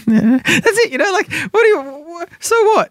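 high put-on voice of a dismissive mum; imitating mother. Continues imitating his mother.

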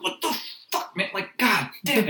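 high, strained mock road rage; double mad. Driver cut off leaving the driveway, double mad. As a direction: curse at the driver in an exasperated high voice. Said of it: "now you're double mad".

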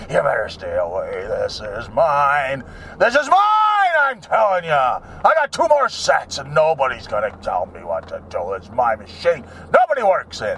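guard the bench like a dog with a bone; territorial gym guy. Retold or imitated. Gym regular to a man waiting, low and possessive. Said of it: "prowl around your machine like".

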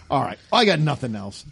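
ordinary talk, nothing marked

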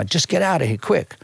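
a hint of worried New York street guy; stranger's urgent send-off. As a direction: hurry him away, lightly acted. Stranger hurries him into taxi.

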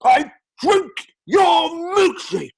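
slow, high, shouted Welsh line. Acted. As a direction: bellow it like a mad oilman. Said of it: "that Welsh accent".

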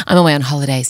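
normal talking voice

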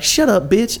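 high-pitched imitation of an irate man; retold heated comeback; mock angry retort. Retold quarrel in the shop.

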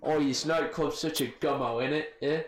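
mock British clubber; casual British slang voice, with a chummy tag question; British accent. Shown as a demonstration. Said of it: "when you say it in a British accent".

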